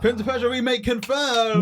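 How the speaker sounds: high, loud announcer shout; mock hype announcement. Announcing a surprise game remake to friends, mock hype shout. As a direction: belt it out like a fake big reveal.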